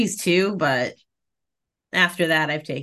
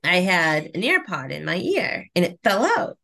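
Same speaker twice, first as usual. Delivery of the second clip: ordinary delivery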